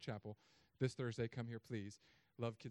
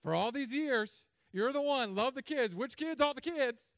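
high, loud mock yell at her husband; wife throwing his words back at him; hammed-up indignant shout; wife's mock outburst; 9 semitones higher, 10 dB louder, slower than usual. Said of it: "This is my wife".

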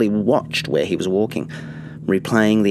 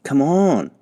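higher, louder whine of impatience; whining; 7 semitones higher, 4 dB louder than usual. A narrator's reading. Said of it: "Castor whined".

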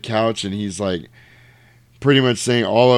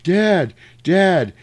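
high, louder mock call; calling for Dad; 8 semitones higher, 4 dB louder than usual.

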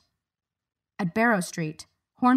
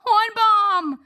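loud, high shout over a din; high loud call over chaos; shouted name; 13 semitones higher, 6 dB louder than usual. A narrator's reading. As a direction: yell his name across the noise. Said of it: "Harriet shouted over the din".

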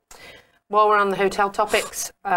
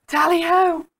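high-pitched, mock-British rallying cry; playful exaggerated British call; hammed-up British; 12 semitones higher, 4 dB louder than usual. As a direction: pipe up like a jolly British caricature. Echoing a British stereotype in banter.

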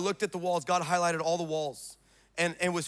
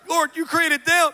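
high, loud, slow mock plea; hammed-up appeal to the Lord; pleading protest; 8 semitones higher, 6 dB louder, slower than usual. Acted protest to God, repeated.

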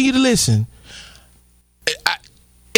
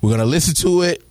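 respectful voice; 12 semitones lower than usual. Pretend. Host explaining the clip to viewers, in a lightly voiced respectful tone. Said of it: "I'm speaking the way I am because I wanna be respectful".

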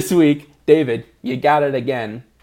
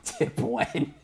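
laughing; 9 dB quieter than usual. Real, not acted. Laughing at the odd phrase.

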